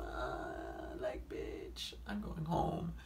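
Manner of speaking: unvoiced hushed mutter; whispered aside. Muttering to herself while answering him. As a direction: whisper it under your breath. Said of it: "I was like".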